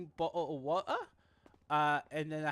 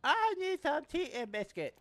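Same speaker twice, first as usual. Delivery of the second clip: put-on British accent; 7 semitones higher, slower than usual. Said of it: "but you're like".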